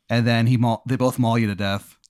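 ordinary talk, nothing marked

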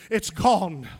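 usual speaking voice

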